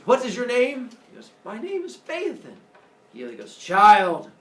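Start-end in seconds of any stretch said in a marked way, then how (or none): 0.1-0.9 loud, lifted formal question; imperious projected voice; commanding god. Acted. Sun god questioning the boy before his throne, loud commanding voice. 1.4-2.5 put-on high-pitched little boy; small high voice before a mighty figure; child's answer. Boy giving his name to the god, high child voice. 3.6-4.3 loud, projected address; one booming word, held out; commanding god. Acted. Sun god addressing the boy, loud proclamation.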